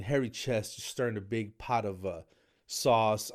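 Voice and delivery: usual speaking voice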